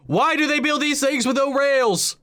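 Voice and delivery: high-pitched, incredulous exclamation; exasperated outcry. Real, not acted. Viewer complaining about the Empire's missing rails, exasperated outcry.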